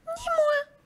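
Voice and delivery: tiny squeak, extremely high; mouse voice. A narrator's reading. Mouse refusing to help the hen, very high squeaky voice. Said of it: "dit la souris".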